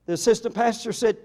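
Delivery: ordinary delivery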